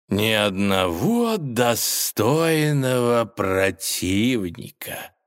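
slow drawn-out bored villain; drawling villain. Acted. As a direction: drag the words out lazily. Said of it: "протянул он". (протяжно, лениво)

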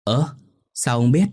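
wife's startled question; surprised. Acted. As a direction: caught off guard by the news. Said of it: "Cô vợ ngạc nhiên hỏi lại".

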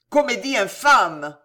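loud, scornful outburst; indignant shout. A narrator's reading. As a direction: denounce it in outrage. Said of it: "vociféra Condé".

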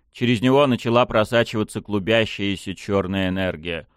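ordinary delivery

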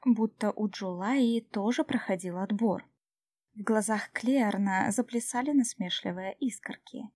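usual speaking voice

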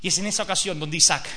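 normal talking voice

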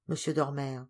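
plain reading voice

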